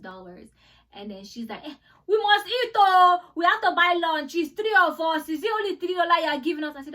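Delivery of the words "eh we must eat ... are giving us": loud, high-pitched imitation of an indignant Nigerian auntie; hammed-up Nigerian auntie complaint; Nigerian accent. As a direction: mimic a Nigerian auntie loudly protesting. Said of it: "I'm even sounding like I'm Nigerian".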